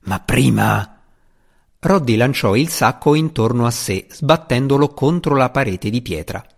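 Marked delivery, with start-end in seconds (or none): 0.0-1.0 slow, low gruff menace; slow threat. A narrator's reading. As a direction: drag out the threat. Roddy trailing threat.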